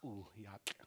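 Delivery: whispered "Ooh, yak" trailing off; unvoiced whisper. Real, not acted. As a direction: breathe it out without voice. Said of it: "it's getting harder to say it now".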